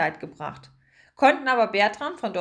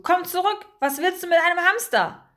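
high, louder call; 9 semitones higher, 5 dB louder than usual. A narrator's reading. Pomme de Terre calls the seagull back, calling out. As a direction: shout after someone flying away. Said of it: "rief Pomme de Terre der Möwe zu".

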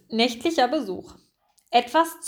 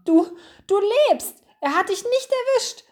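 high-pitched, overjoyed exclamation; voice nearly cracking; 10 semitones higher, 4 dB louder than usual. A narrator's reading. Mouse finds his friend alive, voice almost cracking. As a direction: let excitement push the voice right up. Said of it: "Seine Stimme überschlug sich fast".

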